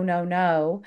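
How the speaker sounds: normal talking voice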